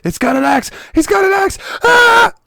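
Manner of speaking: mock terror scream ending in a cry; imitated scream. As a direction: shriek the warning then scream. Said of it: "And I hear".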